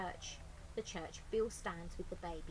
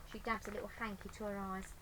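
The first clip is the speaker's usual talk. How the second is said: usual speaking voice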